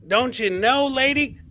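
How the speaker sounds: normal talking voice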